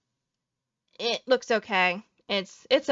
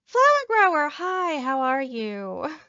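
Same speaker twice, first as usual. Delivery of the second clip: bright high hello to a favorite character; excited greeting; 4 semitones higher, slower than usual. Real, not acted. Streamer greets favorite companion, high excited hello. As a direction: squeal hello at the screen.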